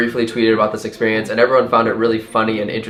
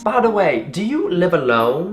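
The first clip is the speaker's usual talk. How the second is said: high, sweet-nosy Southern lady; put-on Southern lilt; Southern accent; 6 semitones higher than usual. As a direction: imitate a prying Southern woman. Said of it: "really bubbly and talkative and Southern".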